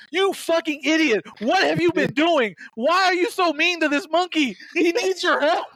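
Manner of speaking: high, slow scolding; scolding Mothra. Pretend. Mothra scolding Godzilla for being mean to Kong, high scold. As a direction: tell him off like an exasperated mom.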